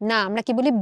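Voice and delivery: usual speaking voice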